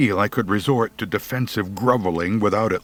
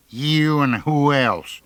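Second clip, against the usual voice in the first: slow, menacing taunt; menacing bully; 7 semitones higher, slower than usual. A narrator's reading. Big bully daring the boy, slow and menacing. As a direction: lean in and dare him.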